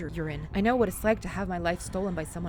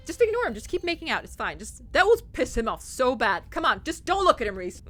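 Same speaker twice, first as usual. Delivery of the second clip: higher, loud pushy urging; excited urging; 5 semitones higher, 6 dB louder than usual. Real, not acted. Player urging Reese to keep making out, loud and excited. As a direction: egg him on, laughing.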